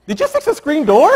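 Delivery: a hammed-up startled question; husband coming home to yet another surprise, laughing; amazed husband. Husband back home, amazed again.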